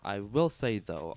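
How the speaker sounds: ordinary talk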